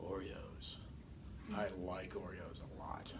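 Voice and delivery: hushed daydreaming student mumble; distracted student thoughts. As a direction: murmur like a bored student daydreaming about snacks. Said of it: "They're probably sitting there going".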